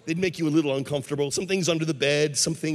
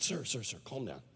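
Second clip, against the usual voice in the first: low, very soft hushing; calming Jesus; 7 semitones lower, 12 dB quieter than usual. Acted. Jesus soothing the angry householder, calming. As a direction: quietly settle someone down.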